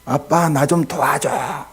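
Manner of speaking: put-on high, pleading cry of a hurting little boy, slowing at the end; imitating a child begging his father for help. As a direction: call to daddy like a scared three-year-old. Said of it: "이렇게 외치는 거예요".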